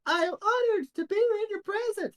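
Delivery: very high, reverent ant voice; squeaky little ant; tiniest little voice. Acted. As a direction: speak as a tiny ant honored by an audience. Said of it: "the tiniest little voice go".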